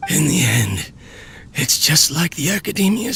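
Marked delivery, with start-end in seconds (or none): 0.1-0.9 choked up. Acted. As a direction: voice catching on the edge of tears. Despairing, near tears.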